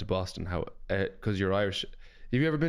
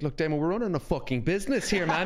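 hammed-up stressed boss, higher and laughing; playing the stingy club owner for laughs; mock boss rant; 8 semitones higher than usual. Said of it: "I was like".

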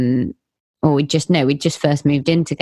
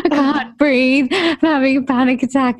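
mock panicked gasp; 8 semitones higher than usual. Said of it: "I was like".

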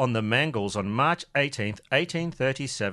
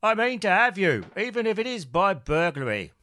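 Cockney Michael Caine voice, pitched up; Michael Caine impression; 6 semitones higher than usual. [name] plots to steal the coat, in a Michael Caine voice. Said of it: "He does sound a lot like him".

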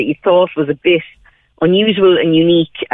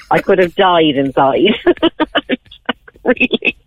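ordinary talk, nothing marked

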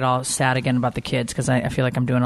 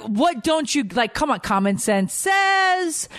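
higher-pitched, drawn-out indignation; retold exasperation; 10 semitones higher, slower than usual. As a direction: argue your case as if he should know. Retold exasperated argument with husband.

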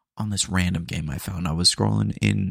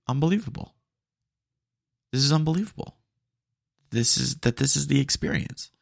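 mock amazed; 4 semitones higher, faster than usual. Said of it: "and I was like".

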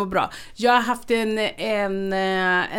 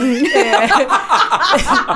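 a burst of real laughter, louder; 3 semitones higher, 8 dB louder than usual. Real, not acted. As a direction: laugh it out loud. Skrattar åt sig själv. (högt, äkta skratt)

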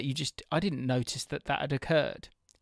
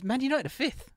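surprised; 11 semitones higher than usual. Real, not acted. Mock disbelief at league table.

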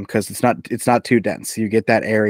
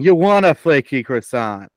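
put-on thick Boston accent, a bit louder; 3 semitones higher, 5 dB louder than usual. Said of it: "just your thickest Boston accent going".

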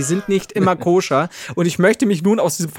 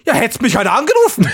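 loud, higher indignant shout; mother's retort; 5 semitones higher, 6 dB louder than usual. Retold or imitated. Mother shouting back at her son, indignant.

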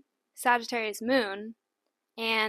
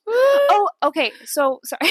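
sudden excited outburst; 10 semitones higher, 10 dB louder than usual. Real, not acted. Sudden loud burst, laughing.

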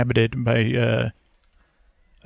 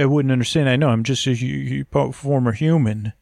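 ordinary talk, nothing marked

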